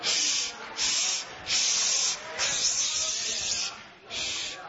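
quiet the saints like a sly devil; devil shushing the church. Retold or imitated. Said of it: "Kinda like that devil does around church".

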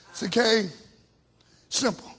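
soft, low, coaxing; gentle God. Retold or imitated. God gently coaxing Cain, soft and low. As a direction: ease him in quietly.

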